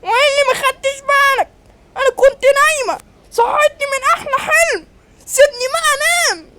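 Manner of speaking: very high put-on voice, held on one pitch; scolding woman. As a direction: complain shrilly as a woman robbed of her sleep. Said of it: "انت بتزعقي ليه؟". (ست صاحية من النوم بتزعق بصوت رفيع)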